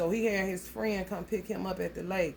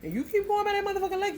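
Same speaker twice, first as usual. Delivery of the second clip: heated warning; 13 semitones higher, much faster than usual. Retold or imitated. Warning a client about the lake, high and rushed. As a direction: pitch jumps way up, rushed fast.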